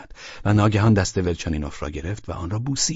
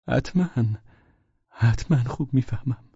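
higher, halting reply; very uneasy; 6 semitones higher than usual. A narrator's reading. Guest reluctantly agrees to the visit, uneasy. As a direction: answer uncomfortably, with a gap. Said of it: "با ناراحتی زیادی جواب داد".

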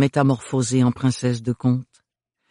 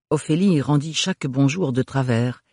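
usual speaking voice

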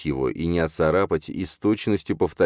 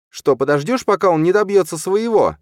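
loud, high-pitched reproach; furious challenge; 7 dB louder than usual. A narrator's reading. Stepmother goading her husband to act, furious. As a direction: goad him with a shrieked question.

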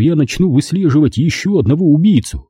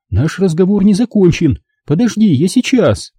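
higher, urgent mother's voice; pleading mother; 7 semitones higher than usual. Acted. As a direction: plead with him as his mother. Mother to son. (мать умоляет сына, высоким голосом)

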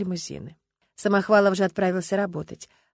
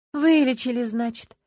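forced smile; 6 semitones higher, 6 dB louder, slower than usual. A narrator's reading. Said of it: "через силу улыбнулась Люба".

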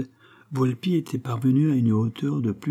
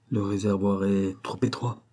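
usual speaking voice

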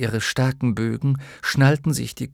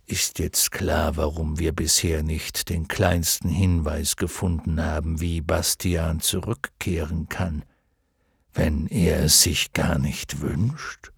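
low, measured dragon voice; dignified luck dragon; 5 semitones lower than usual. Acted. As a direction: speak low and unhurried as a wise dragon. (würdevoller Glücksdrache, tiefe Stimme)

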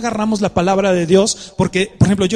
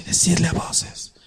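whispered softly, the wrong way; whispered Decidle a voces; 4 dB quieter than usual. Shown as a demonstration. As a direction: hush it like a secret. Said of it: "Calladito así".

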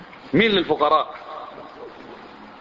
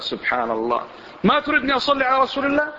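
outraged question, pitched much higher; indignant retort; 9 semitones higher than usual. Retold or imitated. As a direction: play the offended objector, voice jumping high. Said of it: "قال".

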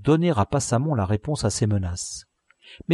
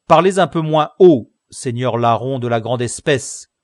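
a sharp, louder scolding; stern rebuke; 3 semitones higher, 9 dB louder than usual. Acted. Commissaire scolding the boastful convict, loud and stern. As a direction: put the thief in his place.

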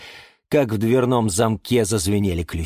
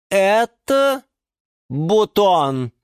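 ЭТО, pause, БУТОН, both high and loud; solemn pause between это and a falling бутон; final verdict; 11 semitones higher, 5 dB louder, much slower than usual. A narrator's reading. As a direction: pronounce это and бутон as separate weighty words. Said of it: "вынесла окончательный вердикт".

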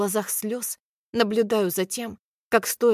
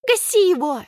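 high fierce cheer; shrieking; 10 semitones higher, 7 dB louder than usual. A narrator's reading. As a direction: urge him to knock him out. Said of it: "верещит Алька".

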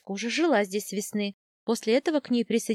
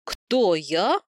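startled, louder question; taken aback, louder on the first word; utterly astonished; 3 semitones lower, 4 dB louder than usual. A narrator's reading. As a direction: ask it in total disbelief. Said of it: "страшно изумился Герхард".

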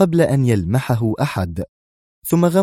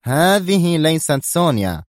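higher, slow, cutting statement; sharp; 7 semitones higher, slower than usual. A narrator's reading. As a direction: say it sharply to the assistant. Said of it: "مستطردا في حدة".

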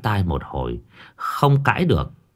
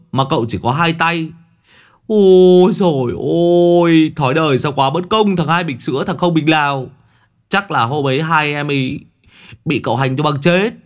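higher, louder hammed-up wail on Ôi dồi ôi; mock lament; 6 semitones higher, 4 dB louder than usual. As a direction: lament life's unfairness in mock tragedy. Long mocks lamenting. (Long Đầu Đất than vãn giả vờ, giọng cao và to)

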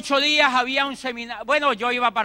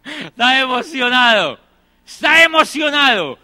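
usual speaking voice

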